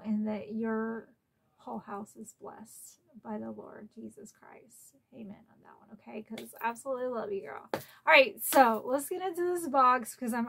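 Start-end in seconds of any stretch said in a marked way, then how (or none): 4.3-6.2 breathy whispered aside; hushed, private amen to the camera. Real, not acted. Quiet aside after blessing.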